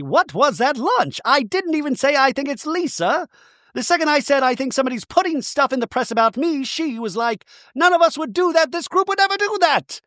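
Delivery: high, exasperated rant; mock indignation. [name] complaining to her friend about lunch, indignant. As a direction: imitate an aggrieved woman, pitched well up.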